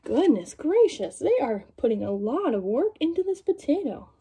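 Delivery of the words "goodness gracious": ordinary delivery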